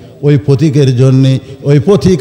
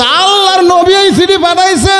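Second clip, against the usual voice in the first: angry retort. Acted. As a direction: cry it out high in exasperation. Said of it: "রাগ করে বলতেছে".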